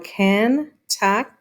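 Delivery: "Ken, tuck, y" with gaps between; slow "Kentucky" as three detached pieces; syllable by syllable. Shown as a demonstration. Teacher counting three syllables, Kentucky fully pulled apart. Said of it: "Kentucky has three syllables".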